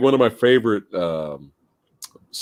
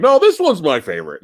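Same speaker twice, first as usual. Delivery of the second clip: higher, louder mock insistence; emfatyczny protest; 9 semitones higher, 5 dB louder than usual. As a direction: insist as if suddenly convinced, voice jumping up. Mock protest changing his favorite.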